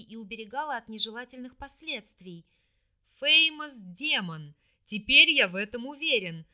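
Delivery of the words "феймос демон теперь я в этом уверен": triumphant roar. A narrator's reading. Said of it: "триумфальный рёв".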